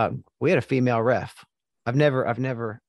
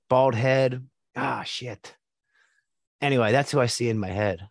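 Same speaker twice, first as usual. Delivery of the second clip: ordinary delivery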